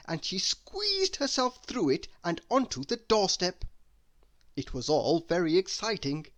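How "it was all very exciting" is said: ordinary delivery, nothing marked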